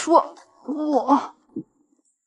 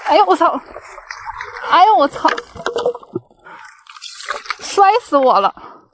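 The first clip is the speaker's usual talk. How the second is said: tripping and falling; 5 semitones higher than usual. Real, not acted.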